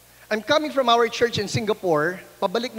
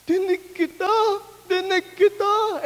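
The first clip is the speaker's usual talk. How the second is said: amazed joy; 9 semitones higher, slower than usual. Retold or imitated. Healed man amazed.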